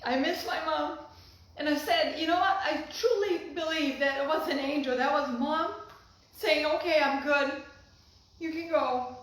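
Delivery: choking back tears while speaking of mom; real tears, voice cracking; crying. Real, not acted. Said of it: "Well, that didn't happen".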